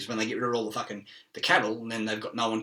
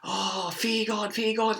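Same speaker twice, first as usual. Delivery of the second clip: high, slow, drawn-out pious warning; high, slow mock warlock sermon; mock preacher; 9 semitones higher, slower than usual. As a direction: parody a preachy zealot. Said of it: "and he's just fucking".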